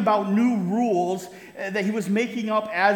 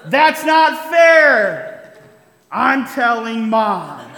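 put-on whiny kid's cry; 2 semitones higher, 10 dB louder, much slower than usual. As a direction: wail it slowly like a kid running to tell mom. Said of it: "the cry rang out".

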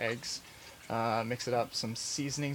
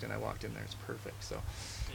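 ordinary talk, nothing marked

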